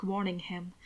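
usual speaking voice

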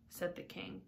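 ordinary delivery

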